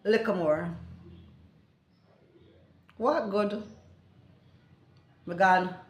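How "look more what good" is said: ordinary delivery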